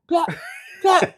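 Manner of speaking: high, sharp "plap" noises spaced apart; comic flapping-shoe sound effect; flapping shoe sole. Retold or imitated. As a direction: imitate a loose sole slapping the floor. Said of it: "You just hear a flapping".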